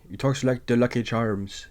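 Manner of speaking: imitating Welles' Irish brogue; Irish accent. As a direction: put on a jokey Lucky Charms Irish voice. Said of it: "Michael O'Hara talks like this".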